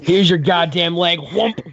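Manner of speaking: loud, high mock attack; playful shout with a turkey leg swing; mock smack. Player acts smacking with a leg.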